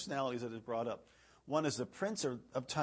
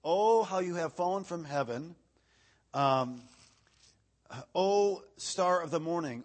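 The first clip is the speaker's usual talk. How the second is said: raised, declaiming pulpit reading voice; scripture reading; 4 semitones higher, 8 dB louder, slower than usual. Acted. Preacher reading Isaiah to the congregation, declaiming. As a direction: proclaim the verse to the room.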